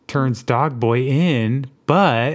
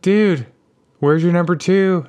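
teasing bros; 6 semitones higher, 3 dB louder than usual. Retold or imitated. Friends teasing at fair.

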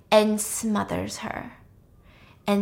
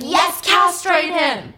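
high, loud, drawn-out cheer; stretched-out gleeful yell; excited cheering shout; 7 semitones higher, 6 dB louder, much slower than usual. Acted. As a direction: holler it like an avenger's cheerleader. Cheers on the revenge in retelling.

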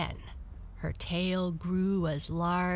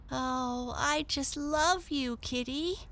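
put-on tender little girl; 8 semitones higher than usual. Girl cuddles the cat.

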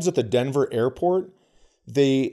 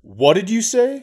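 loud, raised-pitch challenge; confrontational demand; 9 semitones higher, 7 dB louder than usual. Retold or imitated. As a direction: demand it like an offended husband. Retold husband's confrontational demand.

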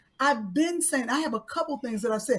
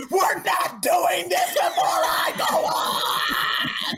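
retold yell; 3 semitones higher, much slower than usual. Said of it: "she turns around and says".

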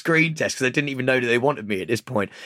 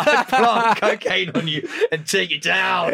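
high, laughing, barely getting words out; cracking up, slow and broken; helpless laughing; 6 semitones higher, much slower than usual. Real, not acted. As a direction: push the joke out through real laughter. Cracking up at own dirty cop joke.

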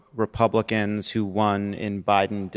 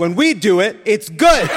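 mock hypocrite; 8 semitones higher, 6 dB louder than usual.

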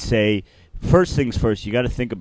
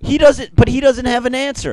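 loud protest; 11 semitones higher, 6 dB louder than usual. Real, not acted. Objecting in the argument.

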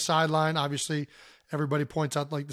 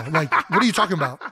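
usual speaking voice

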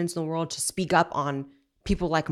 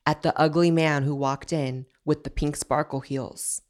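ordinary talk

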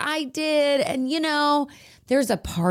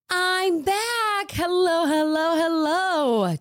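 excited greeting; 7 semitones higher, slower than usual. Real, not acted. Returning guest greets excitedly.

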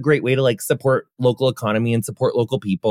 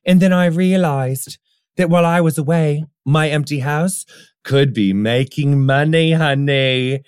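British voice. Pretend. Host hamming up an Airbnb ad read, British voice. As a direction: play it up as a posh-ad British accent. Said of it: "I was doing my British voice earlier".

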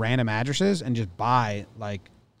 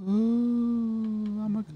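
high, soft, hammed-up ghost moan; spooky ghost voice; 9 semitones higher, 4 dB quieter, much slower than usual. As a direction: play a silly ghost, hooting softly. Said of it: "just ghosting it up".

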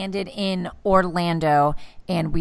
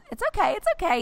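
soothing mom; 12 semitones higher, faster than usual. Retold or imitated. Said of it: "And I'm like".

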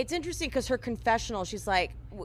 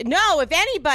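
sharp loud comeback, pitched up; loud retort; 6 semitones higher, 9 dB louder, faster than usual. Retold or imitated. Bethany cutting Jules off, loud and high. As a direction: snap it back at her.

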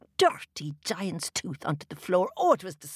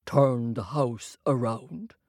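slow, lower, bewildered giant; puzzled giant; 4 semitones lower than usual. A narrator's reading. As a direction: giant can't believe the request. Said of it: "asked the giant".

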